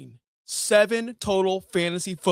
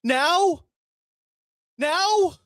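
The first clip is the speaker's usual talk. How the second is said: rising higher in disbelief; much higher, climbing incredulous question; 10 semitones higher than usual. Real, not acted. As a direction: squeak out the second one even higher. Disbelief at player's timing.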